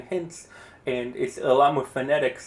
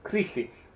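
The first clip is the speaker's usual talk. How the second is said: Crisis said softer and higher as CRI-sis; first-syllable stress; 5 semitones higher, 6 dB quieter than usual. Shown as a demonstration. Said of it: "I'm going to say a word".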